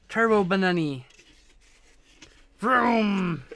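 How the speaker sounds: loud playful car noise; playful excited. Real, not acted. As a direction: hype the pet up, loud and high. Playful pet voice.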